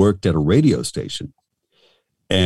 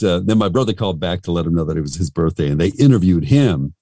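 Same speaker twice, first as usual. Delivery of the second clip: ordinary talk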